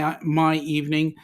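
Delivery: ordinary talk, nothing marked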